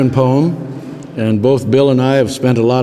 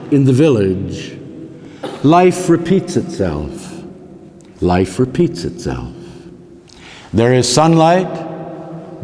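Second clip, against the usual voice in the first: slow, measured poem recital with long pauses; poetry reading; slower than usual. Acted. Reads own poem aloud.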